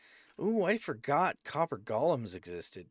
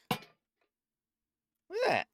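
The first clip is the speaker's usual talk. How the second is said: squeaky, slow "Look at that!"; silly high drawn-out exclaim; 4 dB louder, much slower than usual. Pretend. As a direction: stretch it out in a cartoon squeak.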